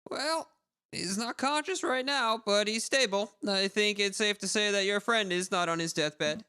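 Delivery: doctor voice. Acted. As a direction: calmly reassure the worried friend as the doctor. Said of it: "oh, voice, voice, voice".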